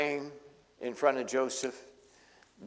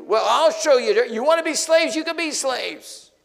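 much higher and louder, hammed-up vengeful roar; mock furious outburst; 11 semitones higher, 10 dB louder than usual. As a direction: play the tyrant who snaps back. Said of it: "You know how that could work".

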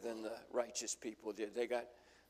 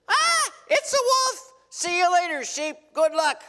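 cowardly hired hand; 17 dB louder than usual. Acted. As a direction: squeal it like a coward running off. Hired hand flees, mocked.

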